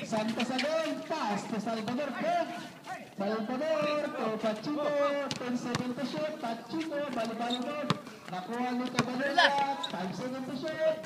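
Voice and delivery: quick courtside play-by-play; play-by-play calling. Acted. Announcer calling the action for the crowd, play-by-play. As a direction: call each pass as it happens.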